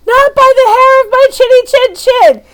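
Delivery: put-on high, loud squealing children; mimicking two little girls squealing the pig story; squealing kids. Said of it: "I hear them squealing".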